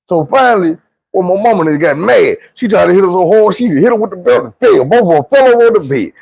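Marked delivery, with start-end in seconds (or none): none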